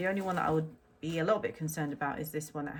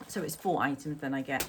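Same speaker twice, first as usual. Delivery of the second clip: ordinary talk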